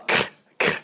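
voiceless scrape at the back of the throat; short raspy kh bursts, no vowel; throaty CH sound. Shown as a demonstration. Teacher isolating the CH sound, voiceless throat scrape. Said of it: "That, that sound".

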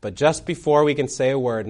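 usual speaking voice

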